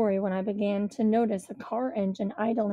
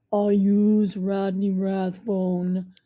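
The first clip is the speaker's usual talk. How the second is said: slow deep voice of a big tough man; 4 dB louder, much slower than usual. A narrator's reading. Big thug asking the narrator for him by name, slow and deep. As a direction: drag it out low like a hulking thug. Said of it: "a deep voice asked".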